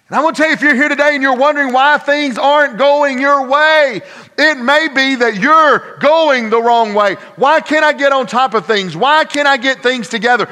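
loud, high preacher's cry; full-force preaching. Real, not acted. Preacher warning the congregation about disobedience, full-force preaching. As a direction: drive each phrase home at full voice.

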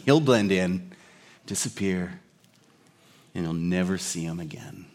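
low, soft, trailing movie-hero bluff; lightly voiced Indiana Jones. Hero bluffing his Nazi captor, lightly acted, low and quiet. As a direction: lightly act a hero's understated warning. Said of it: "But Indy's reply".

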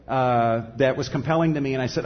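ordinary talk

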